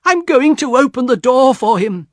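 high, urgent, determined wife; high-pitched and eager, all in a rush of feeling; excited announcement. A narrator's reading. Wife rushing to let son in.

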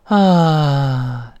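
long contented sigh "ah". Shown as a demonstration. Teacher demonstrates the American vowel as a sigh, relaxed. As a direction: let out a drawn-out, comfortable sigh. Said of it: "发成叹气音".

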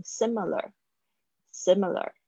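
"Similar" said slowly, first i clear, second i reduced to a schwa; carefully modelled "Similar" for learners; slow pronunciation demo. Shown as a demonstration. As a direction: spell out "Similar" syllable by syllable, unstressed second i. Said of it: "这个I，小I是发I的声音".

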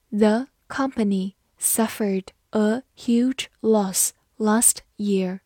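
slow, careful read of each word. Shown as a demonstration. Teacher reads a sentence slowly for the class, slow and clear. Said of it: "好，慢读一遍".

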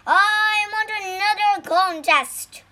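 loud angry demand; demanding shout. Acted. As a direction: bellow it like a sore loser. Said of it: "The tiger immortal stomped over".

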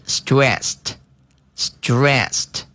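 stressed drawn out very slowly; slow model word. Shown as a demonstration. Teacher slowly models the adjective stressed. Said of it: "是stressed，stressed".

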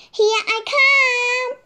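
high, loud racing shout. A narrator's reading. Little Teddy racing Monkey down the path, loud shout. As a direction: charge ahead, yelling out. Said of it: "shouted little Teddy".

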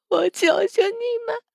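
high, desperate pleading; tearful begging. Acted. Captive begs the attackers, tearful and high. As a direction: beg through sobs. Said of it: "掺和着泪水与汗水".

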